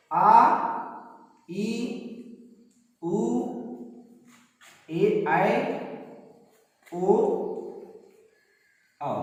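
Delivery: आ, ई, उ, ए, ऐ, ओ, औ each held for two beats; long vowels drawn out. Shown as a demonstration. Teacher demonstrating long vowels to class, drawn out. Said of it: "अधिक समय लग रहा है".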